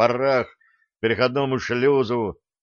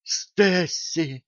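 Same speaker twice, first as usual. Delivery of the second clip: high, gasped name; gasping; 6 semitones higher than usual. A narrator's reading. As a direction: gasp her name in shock. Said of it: "ахнул Джим".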